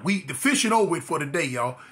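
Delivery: usual speaking voice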